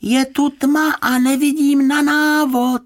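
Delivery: loud, slow, very high squeak; high muffled little voice. A narrator's reading. Trapped magician complaining from inside the chest, high slow little voice. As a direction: wail squeakily from inside a box. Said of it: "ozval se vysoký tlumený hlásek".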